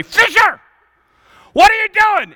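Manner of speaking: high, loud shout at a player; coach yelling. Retold or imitated. Preacher recalls his coach yelling at him, loud shout. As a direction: bellow it like an angry coach from the sideline. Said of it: "I can still hear my coach yelling at me".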